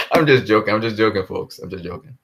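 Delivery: normal talking voice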